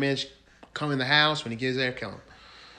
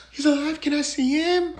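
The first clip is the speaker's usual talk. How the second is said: high-pitched gasping imitation; gasp, then squeal it as a faked shocked wife; mock shocked wife; 12 semitones higher than usual. Storyteller mimicking wife told husband's alive, mock shock.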